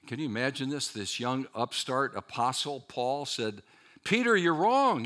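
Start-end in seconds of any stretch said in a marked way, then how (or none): 4.0-5.0 higher, louder, flat-out confrontation; bold rebuke. Retold or imitated. Young Paul confronting Peter to his face, bold loud rebuke. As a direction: call him out to his face.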